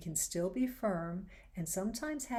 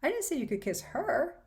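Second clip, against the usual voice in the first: higher and louder take, ending on a rise; shifted stress; 8 semitones higher, 5 dB louder than usual. Shown as a demonstration. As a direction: push this last version up high. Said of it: "you can change the meaning or tone of the line".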